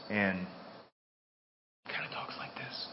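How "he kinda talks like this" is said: soft breathy whisper of God's soft voice. Shown as a demonstration. Preacher shows the congregation God's soft voice, whispering. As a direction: hush it right down to a whisper. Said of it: "He has a soft voice".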